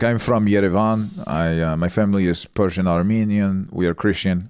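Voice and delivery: put-on Armenian accent, earnest self-introduction. Posing as Armenian to girlfriend's dad, Armenian accent. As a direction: pose as an Armenian guy giving his background. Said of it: "you do a fantastic Armenian accent".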